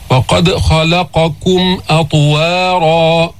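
slow, measured tajweed recitation; steady melodic Quranic chant. Shown as a demonstration. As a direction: chant the verse with long, held vowels.

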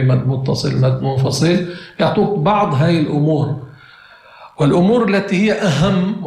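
ordinary talk

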